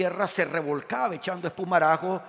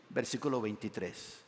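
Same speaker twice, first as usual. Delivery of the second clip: ordinary talk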